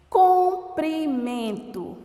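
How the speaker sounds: Comprimento said slowly and clearly, each syllable drawn out; teacher's slow model word; slow demo word. Shown as a demonstration. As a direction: spell it out by sound, slowly: Comprimento. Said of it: "Comprimento é escrito com a letra O".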